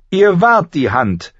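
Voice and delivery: slow, high, loud retort; imperious correction; angry queen. A narrator's reading. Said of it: "setzte Cersei an".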